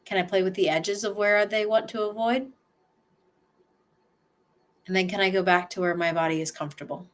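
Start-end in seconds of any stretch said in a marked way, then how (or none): none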